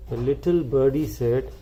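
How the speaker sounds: usual speaking voice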